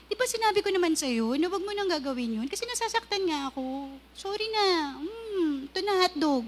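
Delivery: soft, quiet and tender; gentle tone. Acted. Spouse gently reminding partner, soft and tender. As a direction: coax your spouse gently, almost hushed. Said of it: "Pero kung malumanay".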